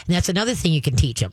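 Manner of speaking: ordinary talk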